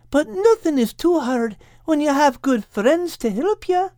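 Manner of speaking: very high-pitched, earnest character voice; gently persuasive Kate. Acted. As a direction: coax a friend in a high, caring voice. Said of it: "trying to persuade Al".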